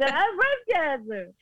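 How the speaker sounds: high, hammed-up sob; imitated tearful squeal; mock wailing. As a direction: blurt it out in an exaggerated wail. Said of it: "That's what it was like".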